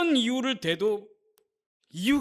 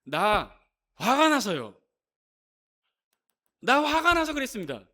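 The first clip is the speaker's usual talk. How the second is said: angry man's excuse; 4 dB louder than usual. Retold or imitated. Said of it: "이 사람이 뭐라고 얘기하냐면".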